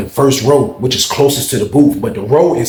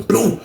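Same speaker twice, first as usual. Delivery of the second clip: boom; 10 semitones higher than usual. Retold or imitated. Door bursting open.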